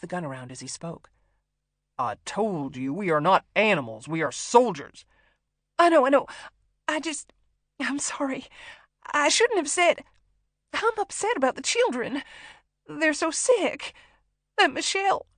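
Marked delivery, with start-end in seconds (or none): 2.0-5.0 loud, emphatic rebuke; forceful, self-righteous declaration; indignant captor. A narrator's reading. Captor rebukes hostage, waving gun. 5.8-15.3 high, tearful, halting explanation; loud, frightened excuses; panicked apology. A narrator's reading. Hostage apologises in panic at gunpoint.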